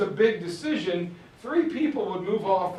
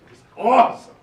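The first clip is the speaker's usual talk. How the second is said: loud, higher-pitched burst of delight; excited young hunter; 5 semitones higher, 9 dB louder, faster than usual. Acted. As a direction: play the thrilled young hunter. Boy rewarded with a hunt.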